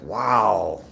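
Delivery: usual speaking voice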